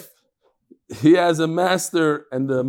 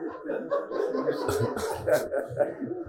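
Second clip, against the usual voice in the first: laughter; 9 dB quieter than usual. Real, not acted. Laughs at own joke.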